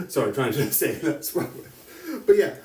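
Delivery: laughing through an apology; giggling while talking. Real, not acted.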